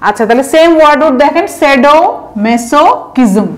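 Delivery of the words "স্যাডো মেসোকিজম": স্যাডো মেসোকিজম split into chunks, a pause between each; broken into parts. Shown as a demonstration. Teacher showing how to break up a long word, said in separate parts. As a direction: say shadow, মেসো, কিজম slowly, one part at a time. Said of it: "ভেঙে ভেঙে উচ্চারণ করবেন".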